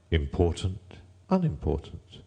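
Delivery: plain reading voice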